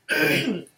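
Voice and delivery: clearing a tickly throat. Real, not acted. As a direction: clear your throat with a cold. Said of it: "as you can hear, excuse me, with my coughing".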